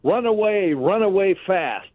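lightly hammed-up, high-pitched cry of retreat; high mock call to flee; comic movie quote. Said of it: "one of the funniest lines is what".

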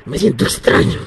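eerie possessed voice, breathy and strange; demon voice. Acted. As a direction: play a possessed man on a prank call. Broma telefónica, voz de poseído. (voz de poseído en broma)

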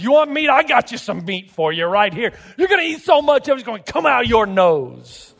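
high, emphatic mock threat; stern God. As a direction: dish out a divine threat, slowing at the end. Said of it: "that's how it reads".

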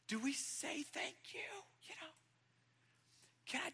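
high hushed whisper of a bewildered disciple; stunned disciple whispering. Pretend. Disciple at the foot washing, high bewildered whisper. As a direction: whisper it like you can't believe it.

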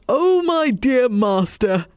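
delighted dog calling out; dog's happy cry. A narrator's reading. Dog telling the cat of his joy, happy cry. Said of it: "cried the dog".

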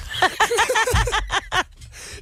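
drawn-out laugh way up high; long high laugh. Real, not acted. Retelling the customs check, long high laugh.

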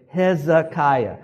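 Hezekiah slow and loud, every syllable drawn out. Shown as a demonstration. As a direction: lead a crowd in saying Hezekiah slowly. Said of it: "That's, again".

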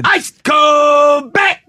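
loud, slow, high hawker shout; beer vendor shout. Retold or imitated. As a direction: drop the R and bellow it. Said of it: "The R is silent".

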